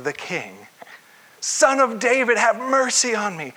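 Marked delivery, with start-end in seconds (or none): 1.4-3.5 high, loud plea; crying out. Acted. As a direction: call out as the desperate beggar, pitched up. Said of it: "crying out at the top of his lungs".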